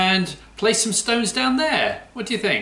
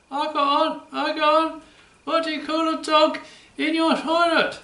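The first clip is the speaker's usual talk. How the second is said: a much higher, squeaky puppet dog voice; 10 semitones higher than usual. Acted. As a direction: play the cheeky puppet George asking the riddle. Puppet George tells a joke.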